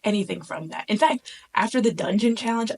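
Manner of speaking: usual speaking voice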